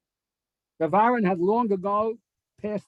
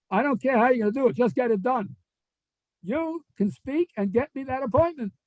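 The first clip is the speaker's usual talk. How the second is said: higher, fast, brooking no excuses; insistent demand; 6 semitones higher than usual. Retold or imitated. Rabbi orders his go-to man, insistent. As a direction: push him hard, no argument.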